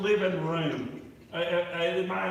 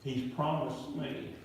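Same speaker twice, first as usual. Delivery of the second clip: ordinary talk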